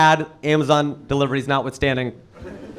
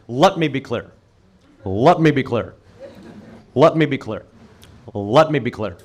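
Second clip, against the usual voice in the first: Obama catchphrase; 5 semitones lower than usual. Retold or imitated. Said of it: "He'd say that all the time".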